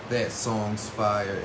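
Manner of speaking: slow, stretched-out praise; lazy stoner drawl. Real, not acted. Laid-back hype for a song.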